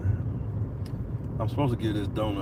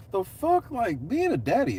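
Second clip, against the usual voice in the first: shrieking high "the fuck?", much higher; a shocked high squeal of protest; comic outrage about being the driver; outraged disbelief; 12 semitones higher than usual. Real, not acted. Outraged at having to drive them.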